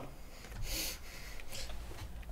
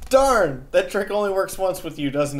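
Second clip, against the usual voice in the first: high mock dismay; 6 semitones higher than usual. Character caught by griffin.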